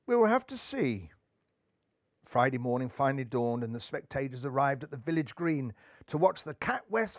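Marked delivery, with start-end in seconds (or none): 0.1-1.1 high, doubtful musing; pondering. A narrator's reading. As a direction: weigh it up aloud. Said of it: "pondered Buntham".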